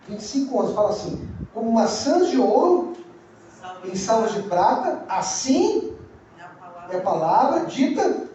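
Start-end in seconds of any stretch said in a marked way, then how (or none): 1.5-8.3 verse recited slowly, phrase by phrase; slow, with long pauses between phrases; slow recitation. Shown as a demonstration. Preacher recites the verse from memory for the congregation, slowly. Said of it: "falar devagar só hoje".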